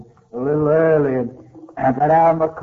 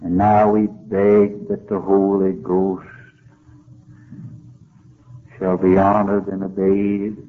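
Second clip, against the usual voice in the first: closing prayer; 5 semitones lower than usual. Real, not acted. Closing prayer led by preacher.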